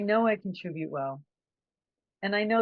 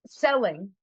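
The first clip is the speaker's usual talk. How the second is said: ordinary delivery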